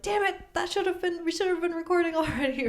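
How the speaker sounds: high, fast frustrated exclamation; exasperated. Retold or imitated. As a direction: kick yourself in frustration. Retold frustrated outburst.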